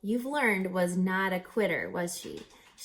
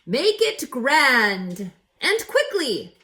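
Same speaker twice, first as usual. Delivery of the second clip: loud, slow, grand commanding voice; president's command; 4 semitones higher, 10 dB louder, much slower than usual. Acted. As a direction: boom the order like a president. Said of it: "he added".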